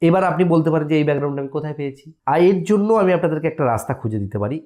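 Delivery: ordinary delivery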